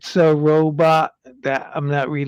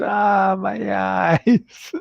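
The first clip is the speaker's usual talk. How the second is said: mock pained cry, a bit higher; 6 semitones higher, 3 dB louder, much slower than usual. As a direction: voice the viewer's playful complaint. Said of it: "Chipper says".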